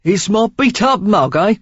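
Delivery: loud Cockney quip; Cockney stranger. A narrator's reading. Stranger joking with Sarah, Cockney. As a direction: joke about his face in Cockney. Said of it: "a healthy dose of Cockney in his accent".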